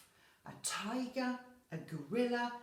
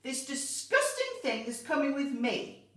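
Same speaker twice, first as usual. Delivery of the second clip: loud, high headmistress bellow; outraged storybook bellow; 6 semitones higher, 7 dB louder than usual. A narrator's reading. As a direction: bellow it with disgust. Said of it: "bellowed the headmistress".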